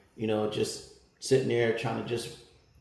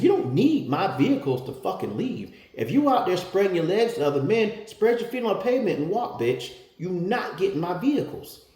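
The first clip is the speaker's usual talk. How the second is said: rip into her with no manners at all; heated, scornful shout at the wife; rude angry retort; 7 semitones higher than usual. Retold or imitated. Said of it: "the same thing I said to her".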